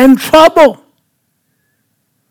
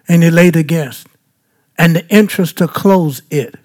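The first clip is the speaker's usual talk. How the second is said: ordinary talk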